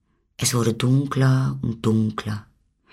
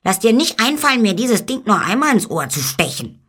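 indignant warning; 11 semitones higher than usual. A narrator's reading. Eamon warns against another jab in the ear, high and indignant. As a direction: warn him off indignantly, pitched high. Jabbed animal angrily warns little man.

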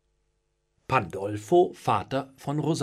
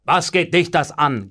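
loud, irritated retort; gruff; 2 semitones higher, 9 dB louder than usual. A narrator's reading. Master to a nosy servant, gruff. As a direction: rebuff the nosy question hard. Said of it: "gab Pandolfo unwirsch zurück".

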